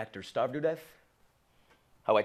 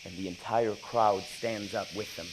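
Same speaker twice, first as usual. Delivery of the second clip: ordinary talk, nothing marked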